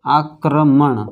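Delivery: आक्रमण drawn out slowly, each syllable clear; careful teacher's आक्रमण; slow sounding out. Shown as a demonstration. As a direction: say आक्रमण slowly, aa-kra-man. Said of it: "देखिए".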